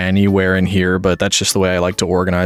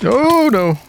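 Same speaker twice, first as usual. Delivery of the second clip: alarmed squeal. Real, not acted. Mobs keep spawning near her.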